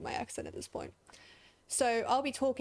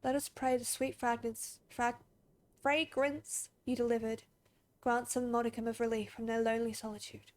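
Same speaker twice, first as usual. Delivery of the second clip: solemn graveyard keeper. Acted. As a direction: speak gently, as one who tends the graves. Reads solemn graveyard keeper's dialogue.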